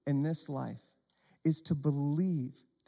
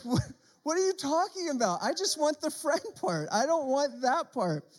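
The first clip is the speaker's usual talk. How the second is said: shocked; 8 semitones higher, 4 dB louder than usual. Pretend. Shocked reaction to doll.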